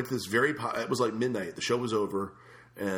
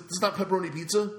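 indignant complaint; 8 semitones higher than usual. Retold or imitated. Complaining to waiter about pizza.

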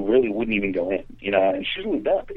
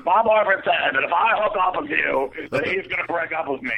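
put-on drunk Pat; 6 semitones higher than usual. Drunk friend warning about her boyfriend, drunk Pat voice. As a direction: put on a higher, tipsy girl voice. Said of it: "It's like a drunk Pat is what you're making her sound like".

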